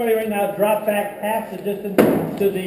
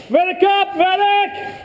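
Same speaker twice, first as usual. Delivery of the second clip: urgent shout for medic; 7 semitones higher than usual. Real, not acted. Calling for a medic.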